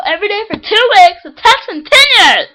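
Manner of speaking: very high, loud, building yell; shouted storytelling. Acted. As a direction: holler the narration, stretching the end. Narration built up to a yell.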